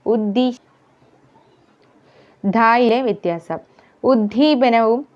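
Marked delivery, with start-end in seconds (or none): none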